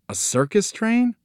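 high-pitched, puzzled question; startled boy. A narrator's reading. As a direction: ask it way up high, taken aback. Boy alarmed by the news.

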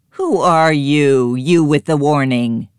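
pig crying out. A narrator's reading. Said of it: "cried the pig".

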